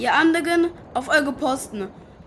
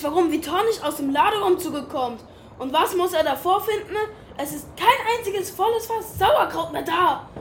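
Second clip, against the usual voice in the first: ordinary talk, nothing marked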